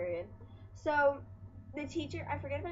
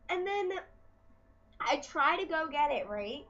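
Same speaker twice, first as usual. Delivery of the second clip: normal talking voice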